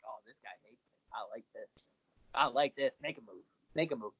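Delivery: very low, hushed aside to himself; low murmured dare; quiet inner thought. Retold or imitated. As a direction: think it aloud, soft and low, relishing it. Acts out own relishing inner thought.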